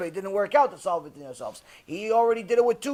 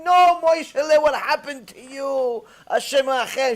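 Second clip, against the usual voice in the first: put-on higher, louder, slowed wailing old woman; wailing scream; 7 semitones higher, 6 dB louder than usual. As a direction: wail in panic over the hurt boy. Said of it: "she starts screaming and yelling".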